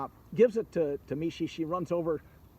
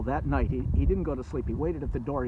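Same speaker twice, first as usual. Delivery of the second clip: plain reading voice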